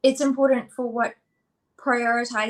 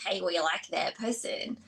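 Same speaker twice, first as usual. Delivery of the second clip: low, soft, slow creepy whisper; creepy inner body voice; 5 semitones lower, 7 dB quieter than usual. Retold or imitated. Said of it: "That's such a creepy voice".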